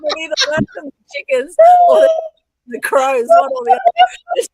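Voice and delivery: high, loud, squealing through laughter; helpless laughter. Real, not acted. Host reacting to the chicken squawk, cracking up. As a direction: barely get the words out for laughing.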